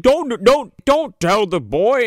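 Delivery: pleading Santa. Retold or imitated. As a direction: beg him not to tell. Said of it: "Santa's like".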